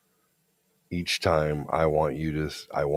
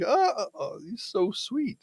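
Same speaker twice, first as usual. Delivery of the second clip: much higher and softer, gushing voice; mock swooning girlfriend; 3 dB quieter, slower than usual. Mimicking her touched reaction.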